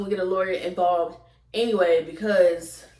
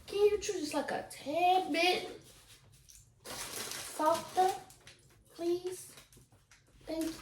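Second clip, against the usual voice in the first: pointed polite request; 9 semitones higher, 9 dB quieter, slower than usual. Real, not acted. Asks someone to chew quieter.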